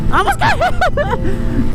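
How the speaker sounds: high, shocked, half-laughing; startled laughing cry. Real, not acted. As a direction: yelp it after a near miss. Near miss while riding.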